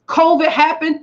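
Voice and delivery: ordinary delivery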